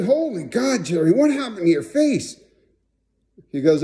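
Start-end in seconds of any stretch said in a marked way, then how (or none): 0.0-2.4 high-pitched, aghast retelling; re-enacted shock. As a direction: exclaim it high, as if appalled at the sight. Shocked at sight of friend's face.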